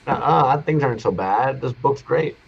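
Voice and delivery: put-on forced cheerful boy. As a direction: brush it off with fake cheer. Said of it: "him trying to be like".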